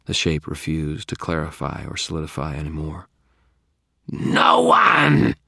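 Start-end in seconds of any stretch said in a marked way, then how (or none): 4.1-5.4 loud, distorted creature shout; screaming monster. A narrator's reading. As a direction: roar out a refusal. Trapped monster screams its refusal.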